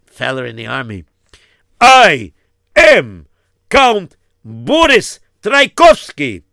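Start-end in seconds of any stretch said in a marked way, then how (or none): 1.8-6.4 much louder, higher, each word hammered; roaring announcement. Acted. Count angrily revealing who he is, roaring. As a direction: thunder out the name and title.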